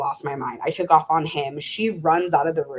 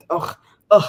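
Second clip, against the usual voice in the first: loud double "ugh"; disgusted groan; 8 dB louder than usual. Real, not acted. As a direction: groan it out at the awful memory. Groaning while recalling the breakup.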